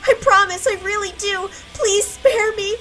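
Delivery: high, loud, crying pleas; begging for mercy. Acted. Child begs the punishing staff, tearful pleading. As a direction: plead for your life.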